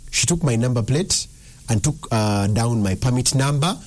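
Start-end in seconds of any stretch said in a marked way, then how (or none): none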